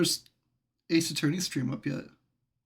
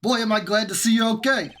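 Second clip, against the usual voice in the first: Gumshoe voice; 5 semitones higher, 4 dB louder than usual. Acted. Same Gumshoe voice continues.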